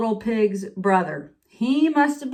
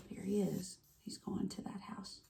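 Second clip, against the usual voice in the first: whispering; 19 dB quieter than usual. Acted. Whispered aside by another reader.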